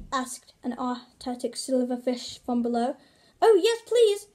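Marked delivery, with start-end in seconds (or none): 3.4-4.3 calling out. Acted. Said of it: "called Gasper".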